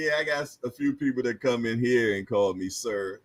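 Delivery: normal talking voice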